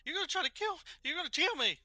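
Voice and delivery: high, loud frightened yelling; frantic high-pitched panic, words tumbling out; panicked woman. Acted. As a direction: scream at the intruders in terror. GM acts panicked armed woman.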